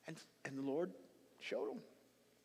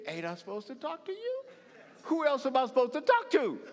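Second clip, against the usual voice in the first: higher, louder, incredulous protest; exasperated protest; 7 semitones higher, 11 dB louder than usual. Retold or imitated. Preacher imagining Moses protesting to God, exasperated. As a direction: push back in disbelief. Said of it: "I'm thinking".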